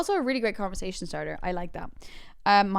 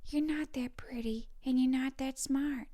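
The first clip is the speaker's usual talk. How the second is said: slow, high, whispery actress impression; Jennifer Coolidge; 5 semitones higher, 4 dB quieter, slower than usual. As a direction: half-whisper it slowly in a put-on voice. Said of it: "That was okay. No, I could do better".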